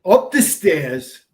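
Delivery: slow, loud and deliberate, every word stressed; emphatic. Real, not acted. Insisting to a doubting colleague, slow and loud. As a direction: spell it out slowly and firmly.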